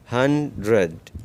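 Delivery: Hundred said slowly, each syllable apart; slow teacher demo of hundred; slow model word. Shown as a demonstration. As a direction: drag hundred out for learners. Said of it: "आगे देखिए".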